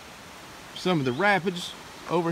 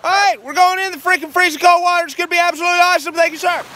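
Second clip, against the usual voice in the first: loud, high, rapid excited yelling; hyped shout; 12 semitones higher, 9 dB louder, faster than usual. Real, not acted. About to jump into freezing water, a loud hyped shout. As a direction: psych yourself up for the icy plunge.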